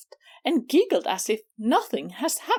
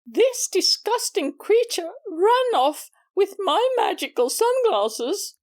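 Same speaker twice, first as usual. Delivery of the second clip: usual speaking voice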